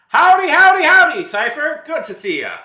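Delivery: high, loud hammed-up Southern howdy; big cowboy welcome to a newcomer; cowboy drawl greeting. Streamer greets arriving viewer in hammed-up Southern accent. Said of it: "I have this accent I've adopted".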